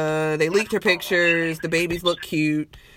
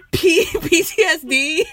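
laughing; 10 semitones higher, 4 dB louder than usual. Real, not acted. Laughs through joke.

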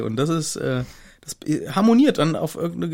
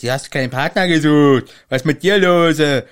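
sneering bully voice, a bit louder; hammed-up snotty schoolboy taunt; lightly voiced Malfoy mocking his classmates; 5 dB louder than usual. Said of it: "der dumme Malfoy sagt".

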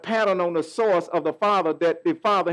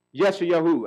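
normal talking voice

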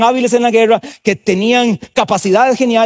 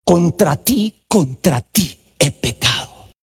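slow, low, hushed reading of a penitent psalm; preacher voicing a contrite psalm, slow and low; prayerful psalm recitation; 5 semitones lower, much slower than usual. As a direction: pray it slowly, as a confession.

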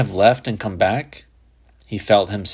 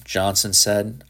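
plain reading voice

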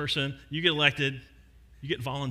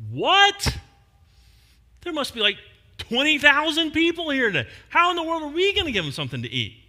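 higher and louder, incredulous outburst; mock disbelief; 11 semitones higher, 6 dB louder than usual. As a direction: throw up your hands: twenty thousand?. Disciples shocked by Jesus' answer.

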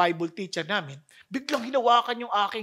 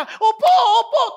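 put-on child agreeing; 14 semitones higher, 10 dB louder than usual. Child giving in.

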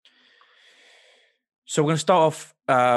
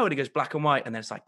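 ordinary talk

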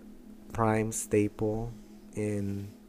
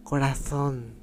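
higher, mimicked accent from Spain; Spanish accent; 4 semitones higher than usual. Showing the accent he doesn't like, mock Spain Spanish. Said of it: "like, a Spanish one".

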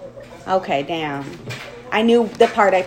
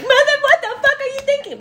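much higher, louder mock self-scolding; berating herself while driving, hammed-up; cussing herself out; 4 dB louder than usual. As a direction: yell at yourself in the car, half joking. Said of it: "and I'm like".

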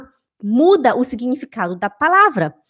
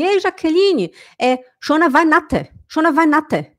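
mock clumsy German, Weihnachten as vanatɐ; hammed-up bad German greeting; foreign accent; 5 semitones higher than usual. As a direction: imitate a foreigner mangling German. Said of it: "Ele queria falar Schöne".

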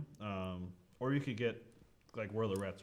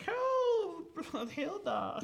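high, squealing call for help; Rat King crying out; 4 dB louder, much slower than usual. Acted. Game master as Rat King calling to Hilda, high panicked cry. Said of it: "and he's like".

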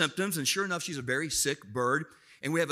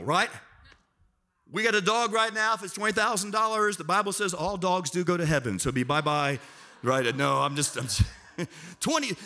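usual speaking voice

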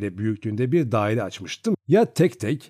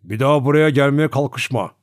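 threatening warning; 3 semitones higher, 5 dB louder than usual. A narrator's reading. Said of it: "parmağıyla beni tehdit ederek".

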